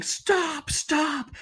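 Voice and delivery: high-pitched retold cry of stop; retold screaming. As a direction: mimic a bystander shrieking for it to stop. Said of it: "somebody starts screaming".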